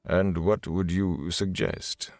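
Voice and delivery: slow, soft and calm; calmly. A narrator's reading. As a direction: ask it unhurried and even. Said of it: "Bornhold asked calmly".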